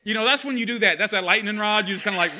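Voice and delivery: loud, driving sermon delivery; fiery pulpit preaching, building and pressing; full-force preaching. Acted. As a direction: preach it hard to the congregation.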